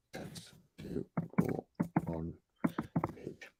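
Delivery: very soft, slow half-voiced murmur; barely voiced, drawn-out self-talk; murmuring while typing. Real, not acted. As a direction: say it under your breath as you type, word by word. Murmuring words while typing them.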